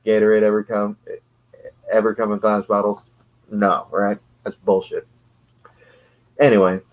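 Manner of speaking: normal talking voice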